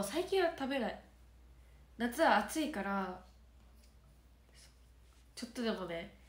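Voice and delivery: normal talking voice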